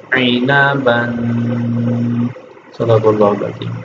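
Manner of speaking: slow, measured spelling-out chant of each syllable; slow recitation drill. Shown as a demonstration. Teacher spelling out the lesson for children, slow recitation. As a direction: recite each letter and vowel slowly, like a Quran teacher.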